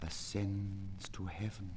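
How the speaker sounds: breathy hushed whisper on the line. Acted. Poet reciting his verse to listeners, whispering. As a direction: drop to a bare whisper.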